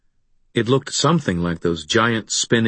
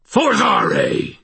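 much higher, loud spell shout; snarled spell; 4 dB louder than usual. A narrator's reading. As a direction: hurl the spell word. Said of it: "I snarled".

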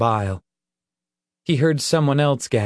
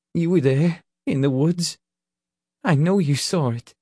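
frightened pleading; 4 semitones higher than usual. Acted. Leroy recounts the terror to Nick.

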